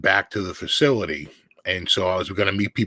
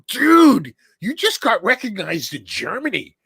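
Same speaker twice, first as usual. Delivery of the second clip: much higher, loud gleeful jab; high, excited ribbing; excited teasing; 12 semitones higher, 4 dB louder than usual. Retold or imitated. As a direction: rib your friend with delight. Friend teasing about being recognized.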